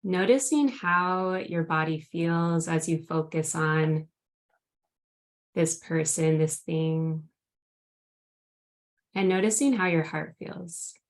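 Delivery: grounding meditation. Acted. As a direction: gently guide them into the present moment. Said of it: "would you lead us in a grounding meditation".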